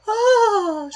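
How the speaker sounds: very high, loud mock gasp; mock fainting gasp. Shocked English lady fainting at the races, mock gasp. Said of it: "I love the woman just".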